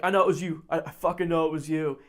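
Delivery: loud, insistent accusation; imitated fury. Angry stranger accusing the wrong friend, imitated fury. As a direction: accuse him forcefully, as a retold bully. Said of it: "furious at my friend Anthony".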